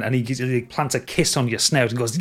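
normal talking voice

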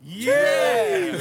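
loud, high excited whoop; hype cheer. Real, not acted. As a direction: cheer it out big. Hype cheer after intro.

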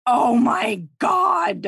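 loud, high, drawn-out exclamation; slow emphatic gush; overwhelmed swoon. Real, not acted. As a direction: stretch it out, utterly overcome. Overcome by the story's ending.

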